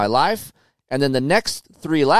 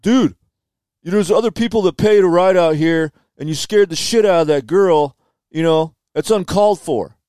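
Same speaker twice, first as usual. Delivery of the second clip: louder, higher scolding voice of the other rider; 4 semitones higher, 4 dB louder than usual. Retold or imitated. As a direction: lecture him as the annoyed dude. Angry rider scolding him.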